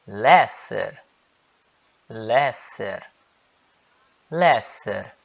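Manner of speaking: "lesser" with the final R sounded; R clearly pronounced. Shown as a demonstration. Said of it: "eu tô pronunciando o R".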